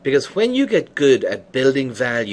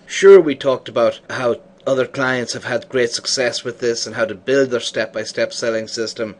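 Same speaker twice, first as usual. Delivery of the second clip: ordinary talk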